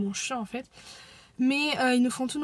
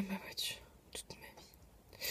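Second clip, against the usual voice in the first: whispering; 5 semitones lower, 21 dB quieter, slower than usual. Real, not acted. Sitting in the car.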